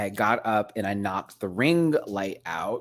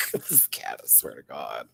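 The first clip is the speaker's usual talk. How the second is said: normal talking voice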